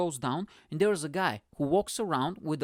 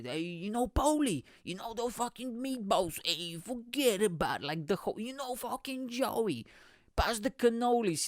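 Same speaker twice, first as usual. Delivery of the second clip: higher, slow mock Italian-American wise guy; 6 semitones higher than usual. Comic rattling off clichés for an Italian-American fan, exaggerated accent. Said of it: "stereotypical shit".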